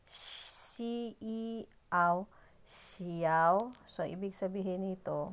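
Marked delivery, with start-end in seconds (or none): 0.1-3.7 "Si, i, aw" slowly, then "xiao." blended; teacher stressing correct "xiao" intonation; sounding out xiao. Shown as a demonstration. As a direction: build "xiao." from its parts. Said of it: "mali yung pronunciation, ay yung intonation".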